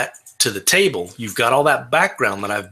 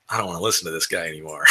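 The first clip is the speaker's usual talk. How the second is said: grumbling listener; 6 semitones lower, 7 dB quieter, much faster than usual. Retold or imitated. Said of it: "heard me do that that thought".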